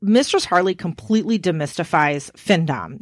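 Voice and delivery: ordinary talk, nothing marked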